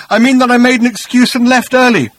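high, loud, emphatic explanation; puzzled. A narrator's reading. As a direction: insist on it, baffled and pitched high. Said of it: "with a puzzled frown".

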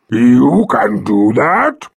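slow, loud wondering creature; curious dragon. Acted. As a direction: rumble the question slowly. Dragon voice held.

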